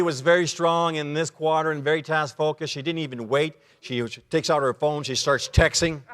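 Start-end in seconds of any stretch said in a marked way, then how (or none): none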